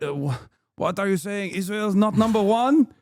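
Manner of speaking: put-on Israeli accent with a rising outraged question; mock Israeli accent. Mocking Zionist commenters in an Israeli accent, outraged. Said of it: "pretty good Israeli accent".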